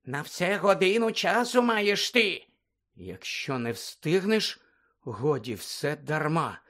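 slow, measured quoting of the song; Harry slowly quoting the mermaid song; recited riddle. Acted. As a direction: recite it word by word, dreading it. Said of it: "процитував Гаррі".

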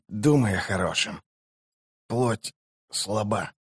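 soft voice, slowing to a halt; dying mage. A narrator's reading. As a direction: fade away as a dying man would. Dying mage speaks to sorceress. (тихий, угасающий голос раненого)

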